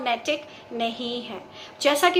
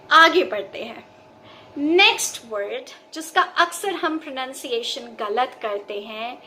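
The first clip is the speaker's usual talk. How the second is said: normal talking voice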